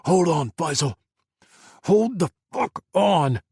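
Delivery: high, loud, dragged-out command; frantic shout. Acted. Adam yelling at Faisal down the satellite line, frantic. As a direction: yell at him to stay alive. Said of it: "his breaths came harsh and frantic".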